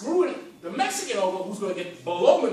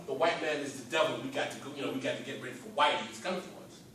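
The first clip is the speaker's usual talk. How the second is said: lower, hushed paranoid send-up; mock separatist; 6 semitones lower, 4 dB quieter, faster than usual. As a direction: parody the alarmist crowd. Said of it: "is not out of some".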